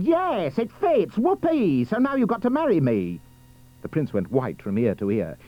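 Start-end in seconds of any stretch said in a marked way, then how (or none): 0.0-3.2 high, gloating ugly sister; triumphant scream. Acted. Ugly sister claiming the prince, screaming in triumph. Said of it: "But she screamed".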